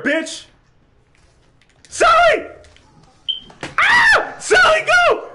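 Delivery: very high, loud frantic screams with nervous laughs; panicked screaming. Real, not acted. Gamer chased by a monster, screaming in panic. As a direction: shriek for Sally in panic.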